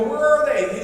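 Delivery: high and loud, pressing; loud demand. Retold or imitated. Dad pressing the ticket seller, loud and high. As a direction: insist on an answer.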